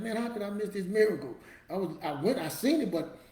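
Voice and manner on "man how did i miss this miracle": loud, high retold amazement; amazed. Man watching George Foreman's story, amazed. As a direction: marvel out loud, pitched up.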